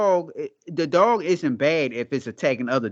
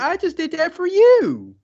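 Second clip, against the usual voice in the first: squeaky falsetto quote, much higher; mocking high voice; 4 dB louder than usual. Mock quote of the husband.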